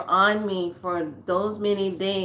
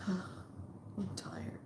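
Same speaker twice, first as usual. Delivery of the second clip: sighed, whispered and spent; a whispered, worn-out sigh; exhausted sigh; 16 dB quieter than usual. Retold or imitated. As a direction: let it out on a tired breath. Exhausted after crying.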